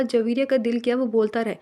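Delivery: usual speaking voice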